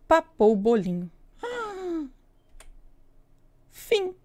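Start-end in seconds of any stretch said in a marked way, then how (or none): 1.4-2.1 high surprised gasp; sharp gasp. Acted. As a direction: gasp in shock. Susto pelo fim. (suspiro de susto)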